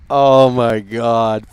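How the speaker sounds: low slow groaned oh my God; low slow exasperated groan. Real, not acted. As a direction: groan it out slowly. Exasperated at trailer.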